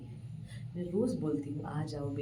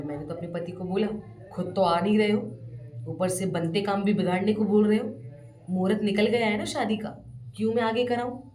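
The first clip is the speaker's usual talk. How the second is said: angry retort; 4 semitones higher, 9 dB louder than usual. Retold or imitated. Wife scolds absent husband.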